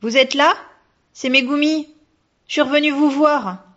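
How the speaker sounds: high, loud call into the darkness. A narrator's reading. Girl calls to the hidden ghost, high and loud. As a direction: call out to someone you can't see. Girl calling into dark house.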